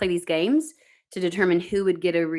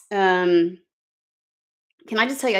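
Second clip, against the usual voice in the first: normal talking voice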